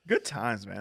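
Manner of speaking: laughing through the words. Real, not acted. Remembering a friend's drunken night with co-hosts, laughing. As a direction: chuckle out a fond memory.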